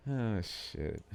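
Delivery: usual speaking voice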